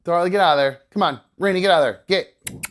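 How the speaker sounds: real shout at the dogs; shooing the dogs. Real, not acted. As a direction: order the dogs out firmly.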